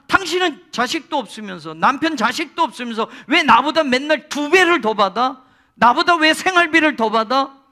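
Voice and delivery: loud, high resentful rival wife; angry complaint. Retold or imitated. Jealous rival wife confronting husband, loud and bitter. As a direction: spit out the jealous grievance. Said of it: "분인나 입장에서 이렇게 말할 수 있잖아요".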